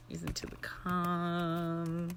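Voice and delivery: very slow unvoiced meditation whisper; hushed guided-calm whisper. Shown as a demonstration. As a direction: breathe the words out slowly. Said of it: "make it more interesting".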